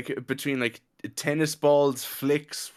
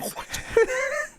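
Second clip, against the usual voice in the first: squealing laugh, pitched way up; high burst of laughter. Real, not acted. Friends laughing at a gym story, shrieking laugh. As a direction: laugh breaks high at the punchline.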